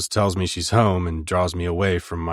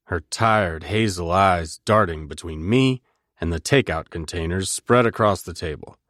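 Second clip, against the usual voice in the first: ordinary talk, nothing marked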